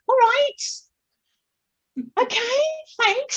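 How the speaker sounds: very high, squeaked little reply; squeaky mock acceptance. Patient answering the blunt nurse, high squeaky voice. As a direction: squeak it out as a put-on polite answer.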